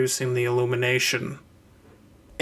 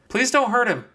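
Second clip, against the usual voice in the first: calling out; 10 semitones higher than usual. Acted. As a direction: call after them. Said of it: "she called out".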